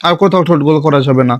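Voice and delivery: ordinary talk, nothing marked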